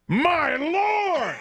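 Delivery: high, drawn-out exclamation of alarm; imitated yell. Dad grabbing the wheel from learner driver, high mock yell. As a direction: imitate a panicked dad yelling. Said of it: "and yells".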